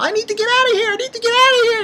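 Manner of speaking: high-pitched mock panic; hammed-up shrieky freak-out. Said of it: "she was freaking out".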